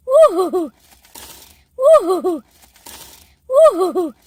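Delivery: loud, high woo-hoo; playful falling whoop; whooping cheer. Pretend. Excited cheer about breakfast.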